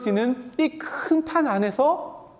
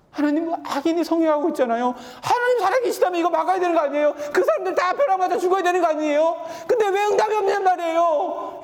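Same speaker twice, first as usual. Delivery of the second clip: pleading prayer; 7 semitones higher than usual. Retold or imitated. Preacher voicing a pleading prayer.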